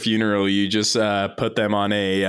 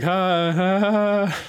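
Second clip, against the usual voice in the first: mock laugh; 10 semitones higher, much slower than usual. Reporters laughing at joke.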